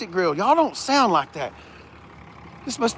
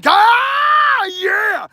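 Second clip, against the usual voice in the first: excited yell; 5 dB louder than usual. Real, not acted. Excited reaction to the truck.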